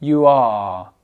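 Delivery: "You are" with "are" drawn out and no hard R; lengthened final R. Shown as a demonstration. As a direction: stretch the end of "are" into a long vowel. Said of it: "d'allonger le R".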